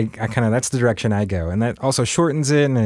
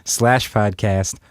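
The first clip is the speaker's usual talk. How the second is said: slow, high announcer read; 6 semitones higher than usual. Acted. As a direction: sign off like a radio host. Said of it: "dust off the old little outro".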